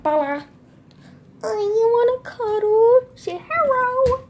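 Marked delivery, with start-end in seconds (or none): 1.4-4.2 high cooing pet voice. Real, not acted. Baby talk to dog.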